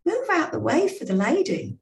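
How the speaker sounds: put-on a rough stab at a Gloucestershire accent, commanding the lads. The captain clearing reporters away in a Gloucestershire accent. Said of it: "best Gloucestershire accent".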